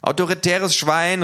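laut, hoch und langsam beschimpfen; wütender Zuruf. Acted. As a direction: spuck die Beleidigung heraus. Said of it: "ruft eine". (wütender Zwischenruf)

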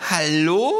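loud, high indignant cry; outraged. Acted. Kangaroo to official, loud high outrage. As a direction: cry out, affronted. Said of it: "ruft das Känguru empört".

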